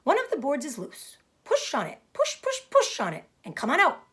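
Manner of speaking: an excited goose urging him on; chattering goose. Acted. Goose urging Wilbur to push the loose board, excited chatter. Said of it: "who talked rather fast".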